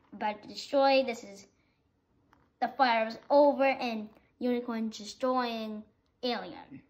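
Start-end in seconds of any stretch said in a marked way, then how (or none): none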